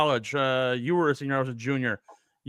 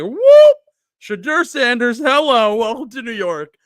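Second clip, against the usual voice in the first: high, loud hammed-up welcome; mock excited announcement; 8 semitones higher, 9 dB louder, slower than usual. Giants front office welcoming their draft pick, in mock excitement. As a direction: announce the pick like a giddy team.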